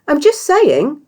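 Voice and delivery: high, loud cheerful protest; bright and insistent, pushing the point; cheery chatter. A narrator's reading. Cheery arguing with Surly on arrival, cheerful.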